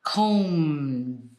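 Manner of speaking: COMBED stretched, M held before a separate voiced D; long humming COMBED with detached D; voiced D ending. Shown as a demonstration. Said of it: "you're using your voice".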